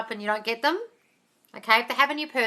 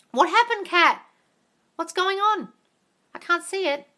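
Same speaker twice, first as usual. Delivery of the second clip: surprised call; 8 semitones higher, 4 dB louder than usual. Real, not acted. Calling out to a viewer.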